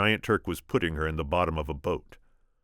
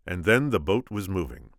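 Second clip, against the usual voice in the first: ordinary delivery, nothing marked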